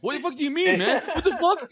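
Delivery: very high, squeaky-pitched protest, half laughing; mock panic. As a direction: play the rookie panicking, pitch shooting up. Acted panic of drugged rookie.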